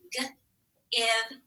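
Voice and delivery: hard g, pause, then short iv; tapped-out sounds. Shown as a demonstration. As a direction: tap out the sounds one at a time. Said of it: "we tap sound".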